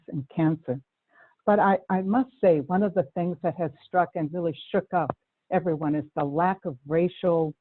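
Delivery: usual speaking voice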